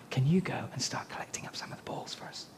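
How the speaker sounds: whispered instruction to a helper; whispered aside. Real, not acted. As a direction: whisper so the crowd doesn't hear.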